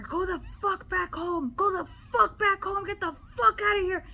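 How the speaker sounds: high-pitched retold yelling; mock furious banishing; enraged order. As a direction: drive it out with repeated high, angry commands. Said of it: "when I was telling her".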